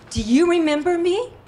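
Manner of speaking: loud, high challenge rising into a question; retold confrontation. Injured woman confronts the driver by phone, loud and challenging.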